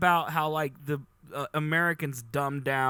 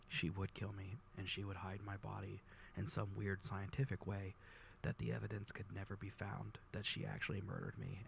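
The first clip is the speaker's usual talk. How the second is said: very low, soft, confiding murmur; quiet mock-serious last testimony; hushed confession; 6 semitones lower, 15 dB quieter than usual. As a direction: confide it gravely, almost under your breath. Mock-solemn confession of fearing Acid.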